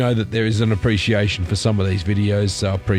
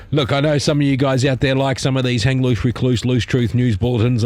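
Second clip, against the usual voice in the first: usual speaking voice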